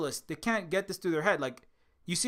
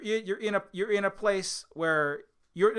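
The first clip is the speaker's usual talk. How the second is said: ordinary delivery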